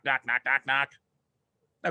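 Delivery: normal talking voice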